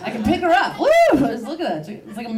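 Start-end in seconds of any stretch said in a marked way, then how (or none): 0.8-0.9 gleeful "woo" cry; playful whoop. Acted. Comic swinging her dog around, playful whoop. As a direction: whoop as if on a ride.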